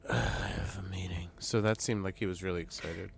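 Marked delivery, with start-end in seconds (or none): 0.0-1.3 airy, put-upon groan imitating a reluctant man; imitated weary sigh. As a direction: sigh it out, mock reluctant and breath-heavy. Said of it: "He was like".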